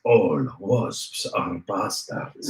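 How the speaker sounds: low, slow and deadpan; deep slow announcer voice. Pretend. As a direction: drawl it out like a movie trailer. Recording a bot response line.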